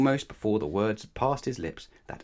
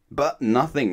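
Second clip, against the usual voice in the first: higher, louder grumpy interruption; 5 semitones higher, 6 dB louder, slower than usual. A narrator's reading. Mister Grumpy cutting off Mister Happy, grumpy. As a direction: cut in crossly.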